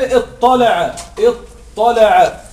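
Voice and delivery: اطلع repeated very slowly; teacher drawing out the word; slow repetition. Shown as a demonstration. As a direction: drag out اطلع for the class. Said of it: "لو فككنا اطلع يكون هكذا".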